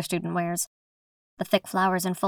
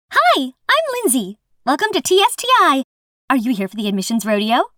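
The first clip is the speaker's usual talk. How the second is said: chirpy; 5 semitones higher, 5 dB louder than usual. Acted. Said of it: "she chirps".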